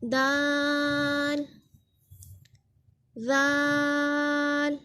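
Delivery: दाल, जाल held very long; slow recited letters. Shown as a demonstration. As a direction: intone each letter slowly.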